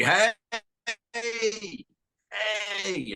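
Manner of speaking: imitating a customs officer's bossy hey hey hey; drill instructor attitude. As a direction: call him over like a bossy drill instructor. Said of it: "some boot camp drill instructor attitude".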